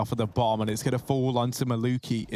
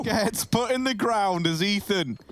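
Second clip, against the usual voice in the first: higher, building hype call; excited play-by-play; 9 semitones higher than usual. Real, not acted. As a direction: ride the rising action.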